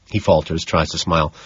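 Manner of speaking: plain reading voice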